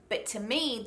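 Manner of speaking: normal talking voice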